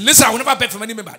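loud, fast, defiant vow; bold declaration. Retold or imitated. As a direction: fire it out boldly at full volume. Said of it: "I was so courageous that I was so bold".

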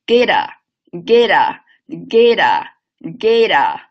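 linking sound drilled slow; linking demo. Shown as a demonstration. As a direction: get said very slowly, again and again. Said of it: "连起来".

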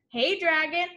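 usual speaking voice

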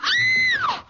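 high piercing scream of horror; horrified scream. Acted. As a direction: shriek at the sight. Woman witnesses the killing.

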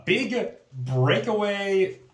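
ordinary talk

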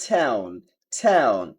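American "টাউন" starting on AE, falling; American accent. Shown as a demonstration. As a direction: say "টাউন" as TAE-oon. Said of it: "America বলে". (American উচ্চারণে)

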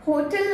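"होटल" with a swallowed last vowel; HO-tul for "होटल"; wrong pronunciation. Shown as a demonstration. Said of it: "नहीं".

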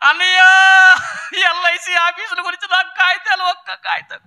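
high, keening mock lament; imitated funeral wailing. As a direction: wail out the grievance like a theatrical mourner. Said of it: "ఇప్పుడు సచ్చినందుకు ఎడుతున్నావా".

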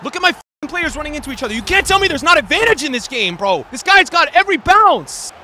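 high, loud accusing outburst; angry loud rant. Real, not acted. Gamer rants loudly about unfair game.

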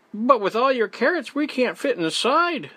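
high-pitched, mildly puzzled beaver; a bit bewildered. A narrator's reading. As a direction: protest in high, slight bewilderment. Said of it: "a bit bewildered".

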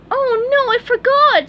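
very high, loud cartoon panic; suddenly remembering; squeaky sponge in alarm; dismayed exclamation. A narrator's reading. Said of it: "SpongeBob exclaimed".

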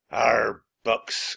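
loud, disgusted pirate growl; growling villain voice; pirate captain's growl. A narrator's reading. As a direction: growl it with contempt. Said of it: "growled Captain Bones".

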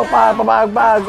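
high rhythmic "pag, pabag" noises; punch sound effects. Retold or imitated. Fight watcher echoing Boy Tapang's hits, high punch noises. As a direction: voice the combination blow by blow.

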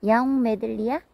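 normal talking voice

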